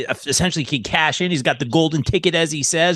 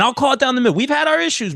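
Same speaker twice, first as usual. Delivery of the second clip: higher, teasing emphasis; playful jab; 9 semitones higher, faster than usual. Real, not acted. Host teasing his guest about past issues, higher playful voice.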